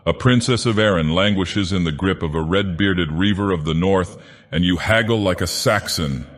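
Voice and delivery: plain reading voice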